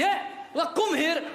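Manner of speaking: put-on high, punchy accented call; father calling out in accented English; father's English. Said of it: "je lui dis".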